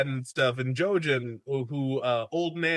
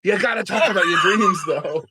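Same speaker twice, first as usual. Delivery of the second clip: insistent, higher and louder; pushy Jojen; 5 semitones higher, 7 dB louder than usual. Retold or imitated. Jojen pushing Bran to talk about dreams, loud and insistent. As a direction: push Bran hard to talk, loudly. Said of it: "Jojen's like".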